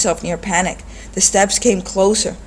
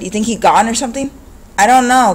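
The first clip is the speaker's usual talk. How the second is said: higher, louder thug; quick street talk; 5 semitones higher, 5 dB louder than usual. A narrator's reading. Thugs talking in the dark house, louder.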